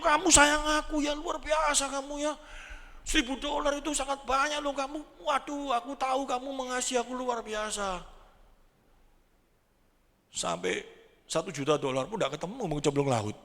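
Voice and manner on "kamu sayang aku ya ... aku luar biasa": high, loud, gushing wife hugging her husband; wife tearful with gratitude. Acted. Wife hugging her husband over the thousand-dollar reward, high gushing and mock tearful. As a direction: gush your thanks like an overwhelmed wife. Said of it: "istrinya langsung nangis".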